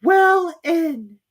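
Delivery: high, slow, drawn-out mouse voice; slow squeaky Dormouse. A narrator's reading. Dormouse answering Alice after long thought.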